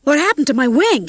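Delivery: high, loud, alarmed question; alarmed cry. A narrator's reading. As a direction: cry out in dismay. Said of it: "he cried".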